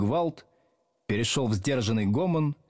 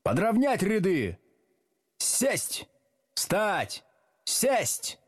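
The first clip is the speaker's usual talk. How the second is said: high, separated one-word commands; thundering drill; 10 semitones higher, much slower than usual. A narrator's reading. Teacher drilling the class up and down, high commands. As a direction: drill them like a sergeant. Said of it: "гремел Слушкин".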